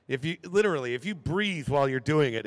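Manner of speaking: ordinary delivery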